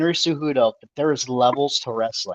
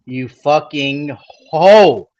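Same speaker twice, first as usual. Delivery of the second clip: drawn-out insult; 3 semitones lower, much slower than usual. Acted. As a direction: stretch the insult out slowly. Angry rant at TJ.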